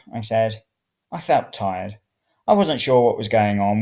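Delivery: plain reading voice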